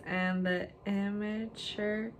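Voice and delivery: slow, stretched-out reading; word by word, unhurried; dictating while typing. Real, not acted. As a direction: draw each word out as you type it. Typing the next line aloud.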